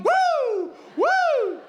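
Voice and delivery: high, far-off whooping cheer; excited whoop. Real, not acted. Comic whoops to rev up the crowd.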